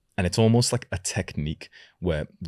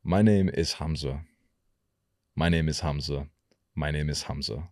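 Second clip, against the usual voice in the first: unhurried, measured delivery with gaps; slightly slower. Shown as a demonstration. Coach demonstrating a change of pace, slower. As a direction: ease the pace down a little. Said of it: "ten to twenty percent slower".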